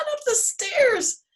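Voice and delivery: high, drawn-out storyteller flourish; excited storytelling. A narrator's reading. Storyteller telling children about Rory's dash, excited. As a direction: stretch it out high, racing upward.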